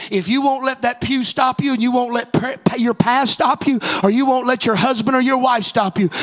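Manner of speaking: loud, driving preacher's cry; full-voiced sermon climax; preaching at full force. Real, not acted. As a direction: push it out to the whole church. Preacher builds to altar call.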